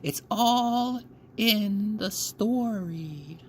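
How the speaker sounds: put-on high, slow, hushed old storyteller; slow storytelling old woman. A narrator's reading. Grandmother opens the bedtime tale to the girl, slow and hushed. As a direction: draw it out like a spell.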